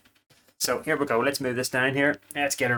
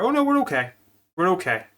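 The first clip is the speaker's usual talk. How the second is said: surprised; 6 semitones higher, 5 dB louder than usual. Real, not acted. Thinks drawer overflowed again.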